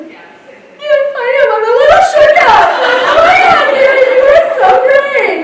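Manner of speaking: high, loud, laughing squeals; laughing retell. Retold or imitated. Caller retelling her call to Gary, laughing high and loud.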